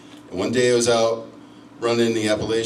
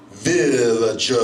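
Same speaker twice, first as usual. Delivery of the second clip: villager stretched out slow; drawn-out insult; 4 dB louder, much slower than usual. Retold or imitated. Comic telling the crowd the insult, drawn out. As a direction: hold the word out long, mock-grave. Punchline quoting the girl's insult.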